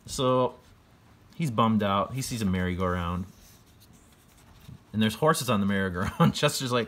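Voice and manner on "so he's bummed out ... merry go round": ordinary delivery, nothing marked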